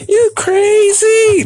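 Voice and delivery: very high, slow, whiny disbelief; hammed-up girl's outraged whine; incredulous girlfriend. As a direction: squeal it like she can't believe it. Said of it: "girlfriend's reply".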